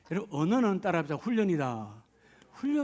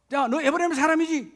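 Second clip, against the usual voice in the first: interrogating soldier; 7 semitones higher, 6 dB louder than usual. Acted.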